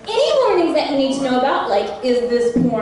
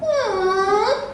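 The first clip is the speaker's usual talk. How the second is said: open mouth worry sound; 7 semitones higher than usual. Shown as a demonstration. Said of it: "depending on how long the character has its mouth open".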